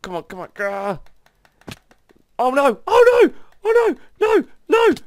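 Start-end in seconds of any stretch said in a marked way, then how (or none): 2.4-4.9 high, loud panicked yelps of "oh no"; panicked cries. Real, not acted. Player flung into the air by the mob, panicked high yells. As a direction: yelp in real alarm as you're flung upward.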